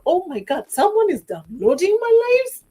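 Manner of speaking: high, loud incredulous squeal; a hammed-up surprised gasp of a line; mock shock. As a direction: play up the disbelief as she retells it. Retelling her own shocked reaction.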